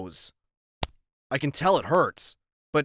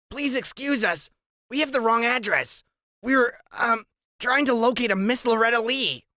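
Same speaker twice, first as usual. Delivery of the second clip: put-on higher, overly polite kid voice; a kid putting on fancy manners, pitched up; polite boy's voice; 10 semitones higher than usual. A narrator's reading.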